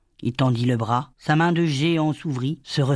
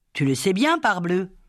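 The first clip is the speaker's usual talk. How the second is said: ordinary delivery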